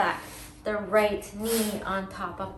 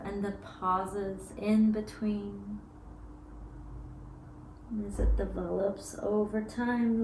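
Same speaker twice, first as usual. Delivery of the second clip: guided meditation; 4 dB quieter than usual. Acted. Yoga teacher guiding students through breath, soft meditation voice. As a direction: ease them into each pause.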